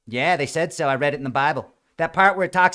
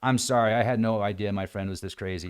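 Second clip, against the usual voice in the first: low, much softer sheepish apology; embarrassed apology; 8 semitones lower, 6 dB quieter than usual. Retold or imitated. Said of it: "his friend was looking at him like".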